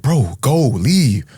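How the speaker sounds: panicked urging to flee; urgent plea. Retold or imitated. Telling the getaway driver to leave, urgent high plea. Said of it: "we're telling the driver".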